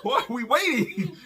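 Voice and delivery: high, slow laughing chop chop; laughing urging. Retold or imitated. Husband laughing while urging his wife to hurry. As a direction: hurry her along through laughter.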